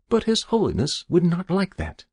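high-pitched, polite objection; deferential keeper. A narrator's reading. Keeper forbidding the angler to stop, deferential.

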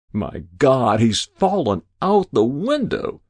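loud exclamation climbing high; alarmed cry. A narrator's reading. Quick-witted editor faking an accident, crying out. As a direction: cry out as if shocked. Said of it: "cried a quick-witted editor".

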